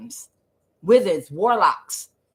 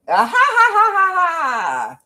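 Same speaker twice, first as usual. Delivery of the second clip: loud, high mocking ha-ha; a jeering high cackle, shouted; mocking laugh; 11 semitones higher, 5 dB louder, slower than usual. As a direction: mimic them laughing right in your face. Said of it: "they laughed in our face".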